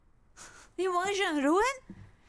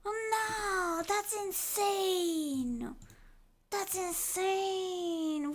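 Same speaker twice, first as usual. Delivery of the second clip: drawn-out amazement; 4 semitones higher, much slower than usual. Real, not acted.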